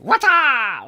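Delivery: high, loud, drawn-out ninja cry; hammed-up martial arts yell; ninja impression. Shown as a demonstration. Presenter showing his ninja impression, mock battle cry. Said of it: "That's my ninja impression".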